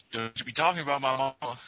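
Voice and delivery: hammed-up offended NPC; mock indignant. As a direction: defend your mama, fast. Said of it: "he's like".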